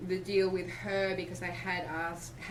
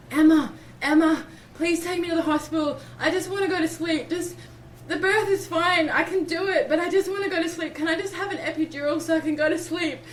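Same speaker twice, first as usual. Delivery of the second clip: high, loud exhausted begging, faster at the end; whiny pleading; 10 semitones higher, 12 dB louder than usual. Retold or imitated. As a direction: whine like a worn-out addict begging. Begging midwife for hospital.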